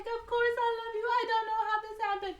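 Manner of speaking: very high hammed-up leading lady; pleading heroine. Accused woman pleads with her lover, mock pleading. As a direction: plead melodramatically in a high put-on voice.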